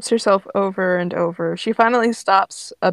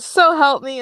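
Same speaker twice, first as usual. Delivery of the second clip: raised, louder warning; exasperated threat; 5 semitones higher, 6 dB louder than usual. Acted. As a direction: threaten through rising frustration. Ember threatens Grape.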